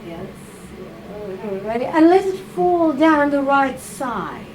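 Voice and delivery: ordinary delivery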